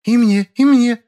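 put-on high, slow, pleading girl voice; eager girl. A narrator's reading. Girls rush up begging for roles.

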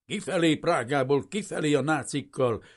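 high, loud angry crowd yell; mob shouting. A narrator's reading. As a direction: bellow it like a raging mob. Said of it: "ordította a csőcselék".

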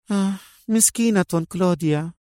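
high, sighing sympathy; pitying. A narrator's reading. Woman pitying the millionairess, high sympathetic murmur. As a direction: murmur with compassion, voice lifted. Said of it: "غمغمت منى في إشفاق".

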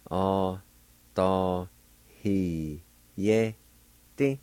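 OSTAYIDISTE said as "ah-tah-hee-yeh-teh", very slowly; syllable by syllable. Shown as a demonstration. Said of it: "is pronounced like this".

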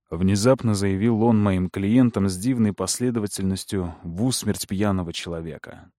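plain reading voice

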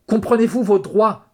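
furious high, loud bellow; yelling. A narrator's reading. Cop to the couple in his car, yelling. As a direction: roar it at full volume. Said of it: "leur hurla le flic".

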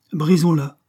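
high, loud cutting-off; abrupt break. A narrator's reading. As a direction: cut the subject short sharply. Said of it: "s'arrêta brusquement". (interruption brusque et forte)

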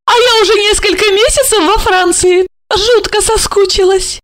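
намного выше, медленно; Ольга, нежно-игриво; голос Ольги. Acted. (Ольга встречает Жарона)

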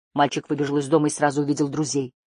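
ordinary delivery, nothing marked

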